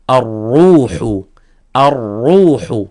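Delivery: slow "الروح" with a heavy, held R; tafkhim ra. Shown as a demonstration. Teacher giving an example of damma ra, heavy demonstration. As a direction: stretch "الروح" with a thick R and long rounded vowel. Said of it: "أيضًا فخمت".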